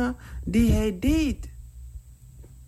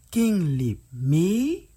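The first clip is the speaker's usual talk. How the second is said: usual speaking voice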